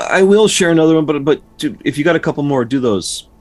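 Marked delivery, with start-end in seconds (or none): none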